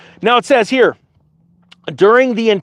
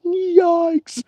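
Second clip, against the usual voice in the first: mock dismay; 4 semitones higher, 4 dB quieter, much slower than usual. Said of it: "It's like".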